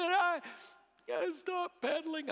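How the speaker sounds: high-pitched, halting tearful whine; crying little boy. Retold or imitated. Small son admits his mistake to dad, crying. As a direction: imitate a sobbing child confessing to dad. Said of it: "and he cried".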